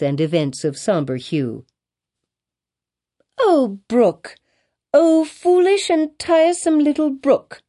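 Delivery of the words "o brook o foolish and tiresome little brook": put-on high, exclaiming child's voice; crying out. A narrator's reading. Child chiding a tiresome little brook, crying out. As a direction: call out high in a young girl's voice. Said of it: "cried Pearl".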